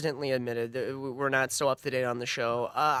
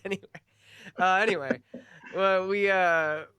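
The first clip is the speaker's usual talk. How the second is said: normal talking voice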